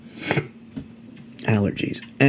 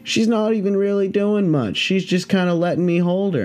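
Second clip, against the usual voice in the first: higher-pitched, breezy handler voice; unbothered snake handler; 8 semitones higher than usual. Retold or imitated. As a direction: imitate a pro who finds this routine. Handler calmly shows off snake.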